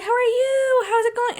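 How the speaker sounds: very high sing-song greeting; gushing acquaintance imitation. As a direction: mimic friends cooing a hello.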